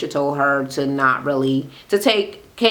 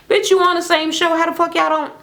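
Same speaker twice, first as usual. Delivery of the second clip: outraged disbelief; 12 semitones higher than usual. Real, not acted. Ranting at a housewife on TV, outraged high disbelief. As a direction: slam her like you can't believe the nerve. Calling out the semicircle denial.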